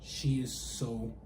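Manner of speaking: awed. Retold or imitated. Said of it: "And then I was like".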